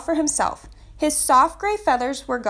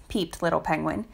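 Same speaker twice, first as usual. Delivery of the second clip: usual speaking voice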